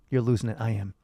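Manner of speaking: ordinary talk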